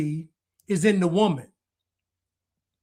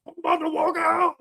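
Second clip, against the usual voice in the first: much higher mock cry-shout; mock crying walkout; 9 semitones higher than usual. Mocking the guest who threatened to walk out, mock crying shout. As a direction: wail it like a fake tantrum. Mocks the walkout man from clip.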